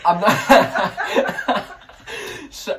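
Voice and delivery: loud, high burst of laughter through the words; laughing. Real, not acted. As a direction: crack up mid-sentence. Bursts out laughing mid-answer.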